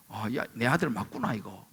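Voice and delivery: low, very soft murmur to oneself; parent's musing. Acted. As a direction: imitate a parent quietly realizing something. Voices parent musing about child. (혼잣말하듯 낮고 아주 작게)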